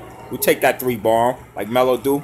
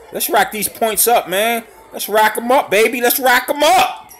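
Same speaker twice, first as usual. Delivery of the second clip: higher, rising hype chant; hyping up; 7 semitones higher than usual. Real, not acted. Urging the team to pile on points, hyped. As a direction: pump the team up.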